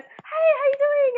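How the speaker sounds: high, slow mock-casual greeting from Tim; imitating Tim. Tim greets the nervous new girl, high mock voice. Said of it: "and he goes".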